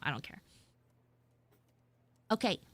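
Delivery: usual speaking voice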